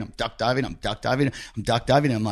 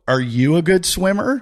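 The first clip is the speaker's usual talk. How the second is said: higher, pointed retort; cocky challenge; 7 semitones higher than usual. Retold or imitated. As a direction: toss the question back, mock-cocky. Throws the question back, challenging.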